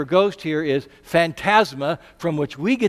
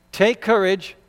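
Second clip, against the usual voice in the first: normal talking voice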